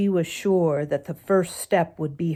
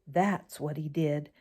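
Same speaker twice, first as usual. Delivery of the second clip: ordinary delivery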